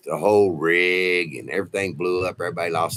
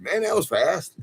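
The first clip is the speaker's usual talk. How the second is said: much higher, amazed exclamation; groggy surprise; 10 semitones higher than usual. Retold or imitated. Waking from the scan, surprised it was quick. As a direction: pop up surprised it's already over.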